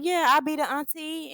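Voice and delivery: high, proud mock reply; mock auntie voice. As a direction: play it as the smug auntie. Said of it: "And she like".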